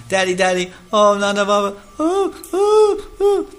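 put-on very high, loud wailing little boy calling daddy; crying child. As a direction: wail like a frightened toddler. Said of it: "jumps in our bed crying".

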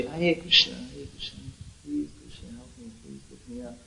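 hushed, desperate plea; barely audible tearful pleading; crying for Krishna. Shown as a demonstration. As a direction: beg for help very softly, as if crying. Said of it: "crying for Krishna".

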